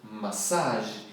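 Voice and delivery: Massage said ma-SAHZH, no final j; English massage. Shown as a demonstration. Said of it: "Não tem nem o j no final". (Massage sem o j no final, massáj)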